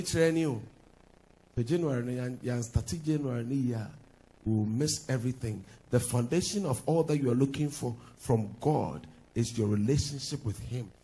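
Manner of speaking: normal talking voice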